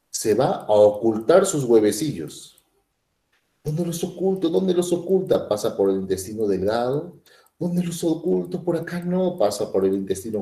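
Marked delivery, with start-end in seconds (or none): 3.6-5.5 hembra buscando escondite. Retold or imitated. 7.6-9.4 hembra buscando escondite. Retold or imitated.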